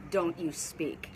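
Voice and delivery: imitating a menacing voice, slow and low; demonic voice. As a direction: draw the words out low, like a demon. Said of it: "the voice that came out of me was demonic".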